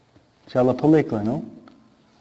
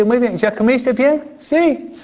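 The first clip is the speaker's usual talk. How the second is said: mom voice; 6 semitones higher than usual. Retold or imitated. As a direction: play the mom calling out. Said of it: "Y mami dice".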